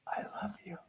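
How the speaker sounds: breathy unvoiced whisper, very soft; whispered I love you. Shown as a demonstration. Coach whispering a mirror affirmation, hushed.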